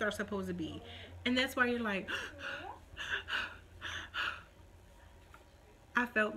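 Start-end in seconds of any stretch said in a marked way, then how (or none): 2.1-4.4 acted breathless panting; mimed shortness of breath; gasping for breath. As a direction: puff and gasp as if winded. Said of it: "I was just out of breath".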